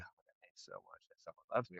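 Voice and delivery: sarcastic breathy whisper, barely there; mock whisper. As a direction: whisper it like a fake thank-you to a stranger online. Mock reply to social media love.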